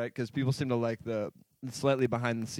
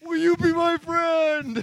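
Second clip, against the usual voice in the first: much higher, slow pleading put-on voice; needy alter ego voice; 14 semitones higher, 4 dB louder, slower than usual. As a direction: beg like a lonely cartoon kid. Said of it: "My voice keeps changing".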